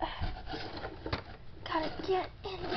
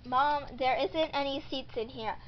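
normal talking voice

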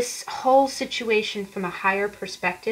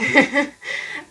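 a quick breathy laugh; short real laugh; 6 semitones higher, 5 dB louder than usual. Real, not acted. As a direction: laugh briefly at your own words. Laugh in the middle of a reading.

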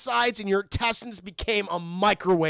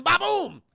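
higher, punchy spoken boom; imitated explosion; 9 semitones higher, 3 dB louder than usual. Storyteller imitates plastic blowing up prison doors, punchy boom. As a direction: voice the blast with gusto.

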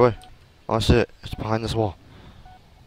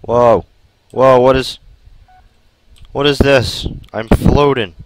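startled shout; 5 semitones higher, 13 dB louder than usual. Real, not acted. Startled by sudden floating.

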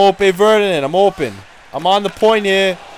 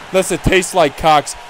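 usual speaking voice

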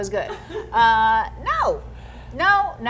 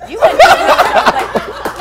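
laughing tease; 11 dB louder, slower than usual. Real, not acted. Teasing repeat of a remark.

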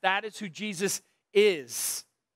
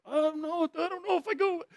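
much higher, put-on child's voice, hesitant; kid dodging mom; 8 semitones higher than usual. Preacher voicing a kid avoiding his mom, mock-timid child voice. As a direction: play the kid pretending not to see mom. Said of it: "'cause your mom, like".

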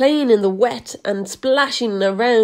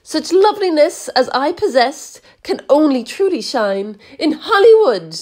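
higher, dreamy boastful aunt; grand vain declaration; 6 semitones higher, 3 dB louder than usual. A narrator's reading. Aunt Sponge dreaming of Hollywood, higher and grand. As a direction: announce her own stardom. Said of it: "Aunt Sponge declared".

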